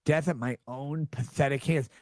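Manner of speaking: low, slow mock-dramatic read. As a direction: read it like melodrama. Reading the caller's dramatic words.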